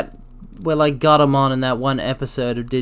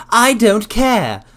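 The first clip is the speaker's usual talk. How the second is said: high, slow bratty yell; posh dumb kid; 8 semitones higher, 6 dB louder, much slower than usual. Retold or imitated. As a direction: shout it like a spoiled posh kid. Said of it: "a posh, dumb kid".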